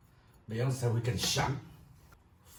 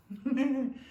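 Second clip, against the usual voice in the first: short high laugh; high burst of laughter; 13 semitones higher, 3 dB louder than usual. Real, not acted. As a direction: laugh at your own joke. Laughs at own boast.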